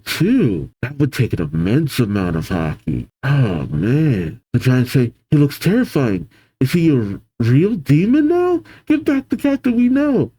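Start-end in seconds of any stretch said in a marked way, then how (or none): 5.3-10.3 building high-pitched distress; alarmed pleading. Acted. Giants confronting demonic captain, high alarmed pleading. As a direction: plead with rising acted desperation.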